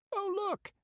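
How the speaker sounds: put-on a piercing, very high girl's cry; shrill out in fright. Girl points at men from the forest, shrilling. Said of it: "shrilled the girl".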